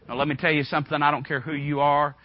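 ordinary delivery

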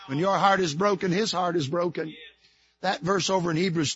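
quiet tender explanation of touched; soft sermon reflection. Acted. Preacher softens to intimate teaching.

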